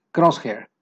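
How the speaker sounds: ordinary talk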